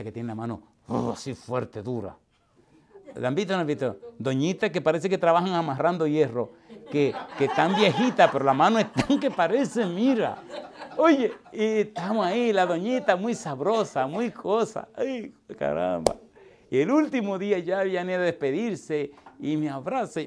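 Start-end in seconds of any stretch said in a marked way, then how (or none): 4.2-14.9 laughing while describing her iron grip. Real, not acted. Preacher amused by an old woman's strong hand, laughing. 14.9-16.2 low, slow mock groan of pain. Preacher pretending his hand is crushed by an old lady, mock pain.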